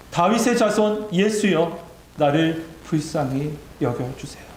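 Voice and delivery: usual speaking voice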